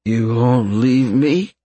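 slurred, drowsy question; slurring. Acted. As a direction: let the words slur sleepily. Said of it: "his words slurring".

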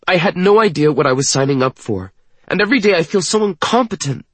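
high, fast, frustrated outburst; words tumbling out in exasperation; impatient, angry. A narrator's reading. Woman confessing she feels incompetent, impatient and upset. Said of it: "She makes an impatient, angry sound".

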